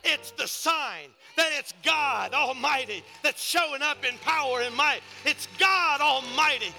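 high preaching voice building to loud shouts; fervent preaching. Real, not acted. Preacher testifying to the congregation about healing oil, fervent and building. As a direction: preach it with mounting force.

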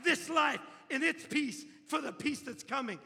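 loud, high preaching at full force, slowing down. Acted. Preacher telling the congregation about eternal joy, full-force preaching. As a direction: proclaim it like a revival preacher.

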